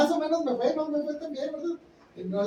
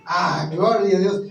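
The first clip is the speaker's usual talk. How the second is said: fervent praise; 5 semitones lower, 8 dB louder, slower than usual. Real, not acted. Preacher praising after the reading.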